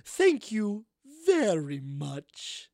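slow grande dame, lilting up to a high farewell; ghostly madam. Acted. Ghost sends the party off, grandly theatrical. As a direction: bid a theatrical goodbye. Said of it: "and she says".

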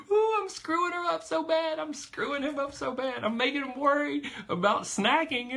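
high sly gremlin gloating; high, gloating mischief voice; gleeful inner saboteur. Acted. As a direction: gloat like a sneaky voice in your head. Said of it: "all or nothing sitting behind the scenes going".

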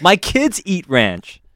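a snide punchline, dragged out. Retold or imitated. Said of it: "this reply from our guy Jay".

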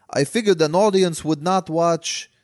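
high, slow put-on foreign accent, meant to be a French filmmaker; high-pitched fake European accent; bad foreign accent. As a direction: do a poor French director's accent. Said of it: "this is not a French accent".

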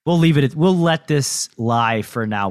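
normal talking voice

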